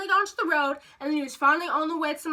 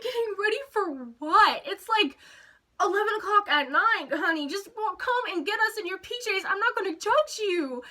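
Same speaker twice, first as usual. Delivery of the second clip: higher, incredulous mock complaint; exasperated; 5 semitones higher than usual. Teller to sister getting ready at eleven, high exasperation. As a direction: pitch it up in exasperated disbelief.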